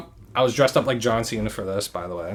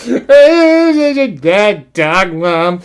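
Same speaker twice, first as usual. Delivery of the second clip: much higher, very slow wail; mock sobbing; 8 semitones higher, 11 dB louder, much slower than usual. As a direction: draw it out like a drunk cry. Imitating drunk crying.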